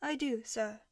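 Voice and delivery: soft voice from a headset; small, tinny assistant reply; AI butler answering through a headset, quiet and tinny. A narrator's reading. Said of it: "Jarvis's quiet, tinny voice".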